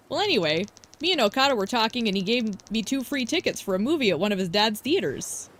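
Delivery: ordinary talk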